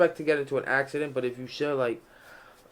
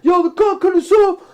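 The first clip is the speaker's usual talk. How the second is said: much higher, loud, pissed-off yelling; drunk angry friend; 10 dB louder than usual. Retold or imitated. Drunk friend cut off by a taxi, loud angry yell. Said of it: "My man's like".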